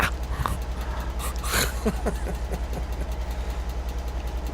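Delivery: airy unvoiced laughter; breathy laugh. Real, not acted. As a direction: laugh silently at yourself. Laughing after speaker impression.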